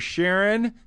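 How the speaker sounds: ordinary delivery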